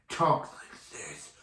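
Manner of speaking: hammed-up growly supervillain voice; Cyborg Superman. Shown as a demonstration. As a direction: put on a terrible Batman voice. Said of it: "talks like this throughout the entire episode".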